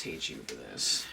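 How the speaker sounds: mock resentful demon grumble; tiny demon. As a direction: voice a sulky tiny demon, dropped way down. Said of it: "just imagine him being a tiny demon and being like".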